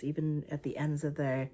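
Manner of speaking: usual speaking voice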